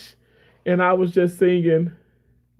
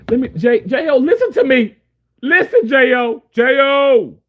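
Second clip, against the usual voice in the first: calling out; 6 semitones higher, 4 dB louder than usual. Real, not acted. Calling commenter loudly.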